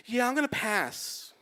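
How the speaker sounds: breezy rejection. Retold or imitated. Breezy rejection in retold call.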